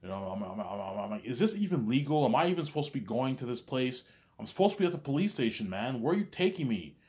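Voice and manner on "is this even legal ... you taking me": high, loud, hurried questions; alarmed protest. Retold or imitated. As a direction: demand where they're going. Panicked questions to cops.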